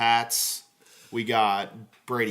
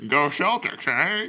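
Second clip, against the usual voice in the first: hammed-up Boston accent, a bit louder and higher; Ted Boston impression; 5 semitones higher, 5 dB louder, slower than usual. Joking to podcast friends, doing Ted in a hammed-up Boston accent. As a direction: play a loud Boston bro cheering in a thick Boston accent. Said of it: "That's a pretty good Ted impression".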